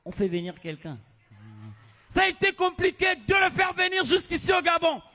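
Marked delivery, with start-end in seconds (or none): 2.1-5.0 loud, high stage introduction; announcer voice. Acted. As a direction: hype the crowd for the act. MC shouting to crowd.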